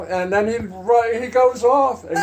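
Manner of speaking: ordinary talk, nothing marked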